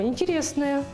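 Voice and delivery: ordinary delivery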